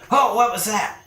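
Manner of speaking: high, startled goblin yelp; alarmed cry from down below; startled creature below. Acted. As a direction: blurt it high, caught off guard. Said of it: "you hear, like, a".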